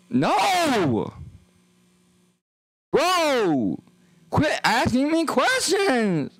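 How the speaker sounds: high, slow, exasperated shouting; fed-up yell. Acted. Streamer yelling at someone asking if he's schizophrenic, high and fed up. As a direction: drag out the complaint, totally fed up.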